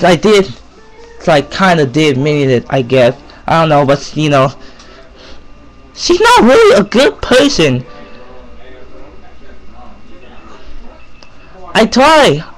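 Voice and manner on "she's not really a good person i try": higher, earnest passionate delivery. Shown as a demonstration. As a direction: say it like you truly mean it. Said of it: "let me use my real voice ... a passionate voice".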